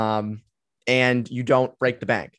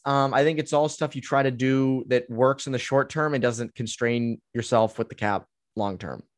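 ordinary delivery

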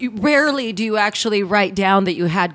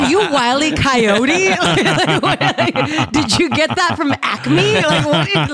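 teasing through laughter, higher; laughing; 7 semitones higher, 3 dB louder, slower than usual. Real, not acted. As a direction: laugh while you tease. Laughing teasing about cartoon coyote.

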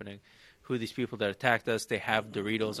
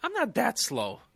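indignant protest; 12 semitones higher than usual. Real, not acted. Protests being called slow.

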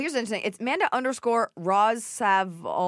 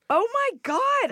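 amazed; 12 semitones higher, slower than usual. Real, not acted. Amazed by her skill.

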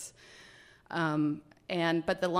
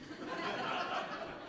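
breathy laugh; 9 dB quieter than usual. Real, not acted. Laughing at her own joke.

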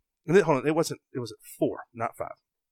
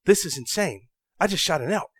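panicked; 7 semitones higher, 4 dB louder than usual. Retold or imitated. Panicked quote after shooting elk.

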